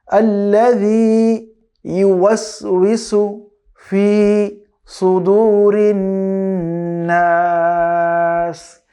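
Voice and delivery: drawn-out melodic tajweed chant; slow Quran recitation. Shown as a demonstration. Teacher recites slowly for students to copy. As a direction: recite each syllable long and measured.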